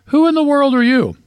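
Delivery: very high-pitched mock incredulity; incredulous. A narrator's reading. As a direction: demand it high, as an acted challenge. Burglar challenges the parrot in joke.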